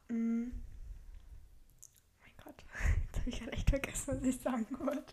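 whispered, fading as the thought slips away; losing the thread in a whisper; trailing off. Real, not acted. Forgets mid-sentence, half whisper.

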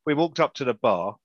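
ordinary delivery

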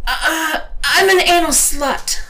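high, loud, hesitant start; high-pitched stammering yelp; stammered outburst. A narrator's reading. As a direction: stumble in, then blurt it loudly. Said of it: "I stammered".

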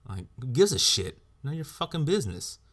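loud, high dismissive snap; furious brush-off; angry retort. Real, not acted. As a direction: shut them down hard.

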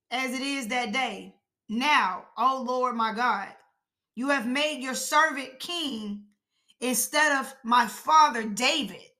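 slow, deliberate Bible reading; measured, solemn verse reading; scripture reading. Acted. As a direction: read each phrase out with long pauses. Reads scripture aloud.

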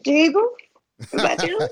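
high, awed gasp of a question; starstruck. Retold or imitated. Fan backstage meeting [name], awed and high-pitched. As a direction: gush like you've seen a saint.

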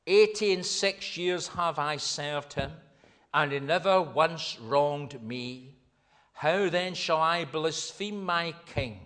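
loud, slow, solemn declaration; defiant martyr's answer. A narrator's reading. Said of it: "And Polycarp answered".

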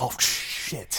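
drawn-out whispered swearing; whispered, horrified realization; hushed dismay. Retold or imitated. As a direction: curse under your breath as it sinks in. Retold shock at dog escaping.

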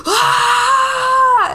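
high, loud mock tantrum scream; imitated toddler scream. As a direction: wail like a screaming kid. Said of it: "I would just scream".

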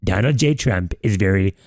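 ordinary delivery

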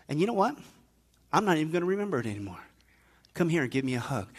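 hushed, low fatherly voice; tender forgiveness. Acted. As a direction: speak very softly, with long pauses, as a forgiving father.

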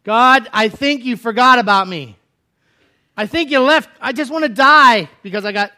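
high, loud anguished plea; Elijah crying out. Acted. Elijah telling God he wants to die, high anguished cry. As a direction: plead with God in despair. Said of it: "Elijah cries out at that moment".

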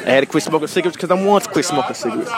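ordinary delivery

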